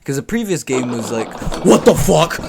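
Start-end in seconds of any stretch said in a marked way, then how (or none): 1.6-2.4 loud exclaim. Retold or imitated. Retold reaction to previous game.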